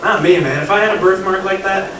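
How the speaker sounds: ordinary delivery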